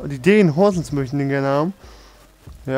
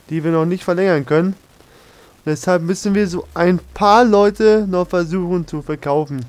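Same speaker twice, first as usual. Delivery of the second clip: ordinary delivery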